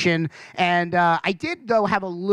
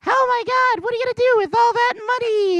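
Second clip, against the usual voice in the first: very high, loud gushing squeal; gushing game show host; 4 dB louder than usual. Retold or imitated. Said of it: "She goes".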